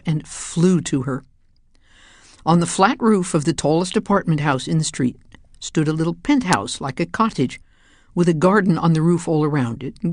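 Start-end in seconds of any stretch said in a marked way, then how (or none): none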